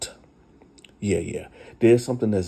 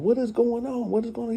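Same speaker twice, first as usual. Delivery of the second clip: high, startled cry of confusion; wife arriving home, shocked by the yard fight; alarmed surprise; faster than usual. Retold or imitated. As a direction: pull up on chaos and demand answers.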